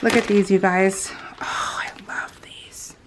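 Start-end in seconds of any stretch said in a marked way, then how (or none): 1.4-2.9 slow, tender whisper. Real, not acted. Showing viewers charms she loves, whispering. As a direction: whisper it lovingly, slowly.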